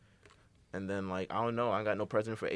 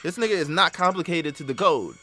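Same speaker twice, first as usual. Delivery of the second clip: louder, higher exasperated rant; 6 semitones higher, 7 dB louder than usual. Real, not acted. Mocking the rapper's lyrics to his cohost, loud exasperation. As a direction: raise it in disbelief at the bars.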